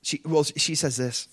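normal talking voice

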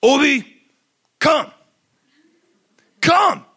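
put-on loud, high toddler command; three-year-old's power voice. Little boy orders the big dog to come, mock commanding shout. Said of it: "he says in his deepest voice".

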